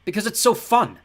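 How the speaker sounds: high, loud sarcastic retort; irritated growl. A narrator's reading. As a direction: snap back in loud irritation. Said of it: "I growled".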